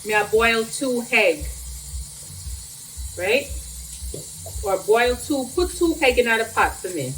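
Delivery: "put two egg in the pot for me" in Jamaican patois. Shown as a demonstration. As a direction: vlogger demonstrates a Jamaican patois phrase. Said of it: "They say".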